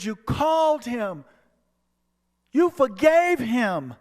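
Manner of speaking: ethereal voice. Retold or imitated. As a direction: speak as a joyful heavenly voice. Ethereal voice speaks.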